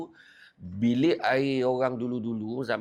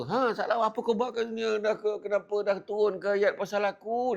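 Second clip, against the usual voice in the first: much higher, pressing voice of Umar; urgent questioning; 9 semitones higher than usual. Acted. Umar confronting the youth in the cave, higher and urgent. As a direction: demand an answer from the hiding youth.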